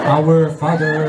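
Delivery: low, very slow prayer opening; slow, grave start of a prayer; captain praying. Acted. Captain turning to prayer in the crisis, low and very slow.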